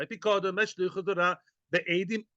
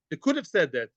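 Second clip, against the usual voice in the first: ordinary talk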